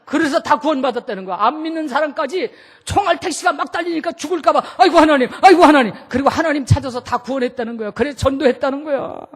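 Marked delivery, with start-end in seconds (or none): 4.8-5.9 loud hammed-up panic cries to God; comic frightened outcry; mock terrified wailing. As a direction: wail like a passenger in a speeding taxi. Passengers terrified in speeding taxi.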